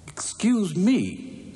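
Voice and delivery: drawn-out prim politeness before a punch; polite princess. Acted. Princess to the monster before punching him, primly polite.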